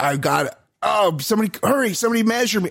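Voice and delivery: high, fast, frantic plea to be measured; frantic excitement. Retold or imitated. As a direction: rush it out, voice jumping way up. Retold frantic call after race.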